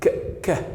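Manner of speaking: "ك" as a short, isolated K; hard K sound. Shown as a demonstration. Teacher answering how final C is pronounced, isolated hard K. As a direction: give "ك" alone as a crisp K. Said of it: "اتنطط ايه في الاخر؟".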